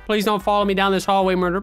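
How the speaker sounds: normal talking voice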